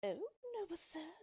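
high, imploring queen; great distress. A narrator's reading. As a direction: plead in desperation. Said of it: "said the queen in great distress".

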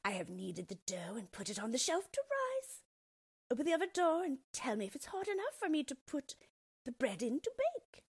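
high, odd witch voice; a scheming witch, sweetly. A narrator's reading. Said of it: "said the witch".

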